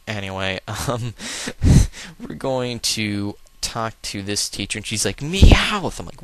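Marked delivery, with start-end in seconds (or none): 1.2-2.1 burst of laughter. Real, not acted. Laughs at own wink joke. 5.2-6.0 a very high, loud screech of Meowth; sudden scream. Retold or imitated. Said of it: "she just randomly screamed".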